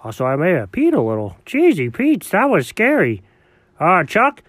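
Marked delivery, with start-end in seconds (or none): none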